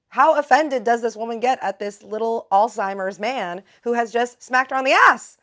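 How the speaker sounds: loud, gleeful teaser; excited outro. Real, not acted. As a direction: hype up the next episode. Outro teasing next episode.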